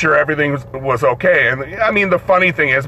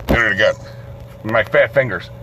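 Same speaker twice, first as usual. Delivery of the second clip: ordinary delivery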